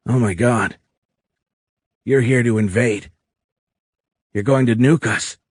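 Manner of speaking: dawning dread, phrase by phrase; horrified realisation. A narrator's reading. Tony thinks the alien will nuke Earth, horrified.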